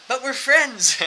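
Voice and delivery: high, loud protest; mock-hurt plea. Friends pleading with threatening man, mock-hurt plea. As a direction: whine loudly in pretend betrayal.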